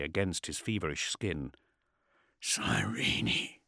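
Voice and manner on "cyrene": low-voiced, breathy growl; strained, inhuman rasp. A narrator's reading. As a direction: growl it as if barely yourself. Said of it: "he growled, his voice barely his own".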